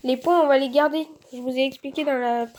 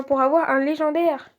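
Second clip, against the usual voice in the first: ordinary talk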